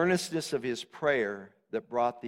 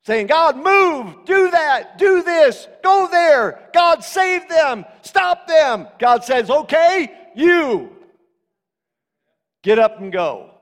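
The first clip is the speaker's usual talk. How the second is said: high, loud shouted exhortation; full-force preaching; 12 semitones higher, 12 dB louder than usual. Acted. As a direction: fire commands out at the congregation like a fiery preacher.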